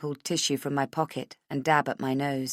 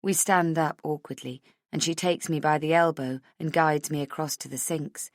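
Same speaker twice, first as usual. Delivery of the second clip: plain reading voice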